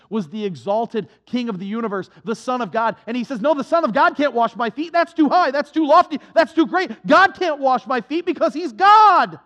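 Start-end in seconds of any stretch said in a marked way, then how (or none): 3.4-9.4 higher and louder, indignant protest; Peter protesting. Acted. Peter protests that God cannot wash his feet, loud and high. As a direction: refuse him outright, scandalised.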